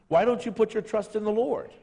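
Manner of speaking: normal talking voice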